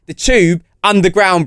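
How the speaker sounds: ordinary delivery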